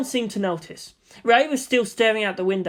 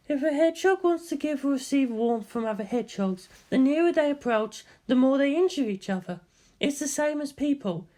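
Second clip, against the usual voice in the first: higher, musing female voice; voiced female character; 7 semitones higher than usual. Quoted hedgehog line in voice.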